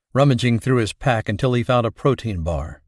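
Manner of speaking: ordinary delivery, nothing marked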